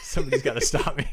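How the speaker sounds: can't stop laughing at yourself. Real, not acted.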